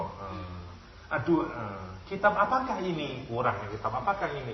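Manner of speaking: ordinary talk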